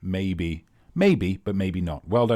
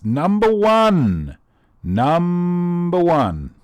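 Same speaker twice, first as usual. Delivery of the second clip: drawn-out announcer call; 7 semitones higher, 6 dB louder, much slower than usual. Acted. Quiz host announcing the round.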